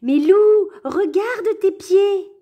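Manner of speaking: loud, high, slow call; shout. Acted. The friends shout at the wolf to look at his feet. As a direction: yell it to get his attention. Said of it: "il crie".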